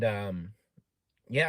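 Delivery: normal talking voice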